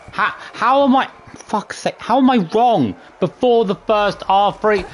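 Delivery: real frustration boiling over; can't believe it's counted wrong; exasperated outburst. Real, not acted. Losing patience with the game.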